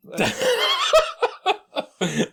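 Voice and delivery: high-pitched burst of laughter; loud helpless laugh. Real, not acted. Laughing at his own D-Day comparison, loud helpless laughter. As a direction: crack up loudly, pitch shooting right up.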